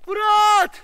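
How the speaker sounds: loud, high holler for a lost friend; far-carrying yell; calling out. Acted. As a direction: shout the name out into the woods.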